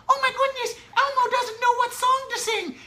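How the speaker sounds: silly Elmo voice. Shown as a demonstration. Parent reading to William as Elmo, very high silly voice. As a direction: play Elmo for a toddler, way up high. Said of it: "and say".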